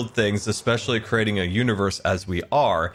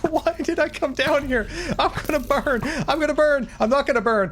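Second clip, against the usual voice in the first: panicked. Real, not acted. Panic while burning in game.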